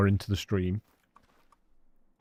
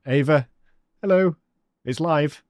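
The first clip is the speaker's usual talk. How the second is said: calling hello; 7 semitones higher, 7 dB louder than usual. Real, not acted. Streamer calling out hello to a viewer's daughter, loud and high. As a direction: sing out a big hello to Ava.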